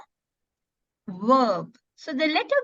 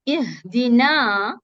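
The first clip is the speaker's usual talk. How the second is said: ihdina spoken slowly and carefully; slow recitation; 2 semitones lower, much slower than usual. Shown as a demonstration. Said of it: "it's a alif mad".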